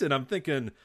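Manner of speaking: ordinary delivery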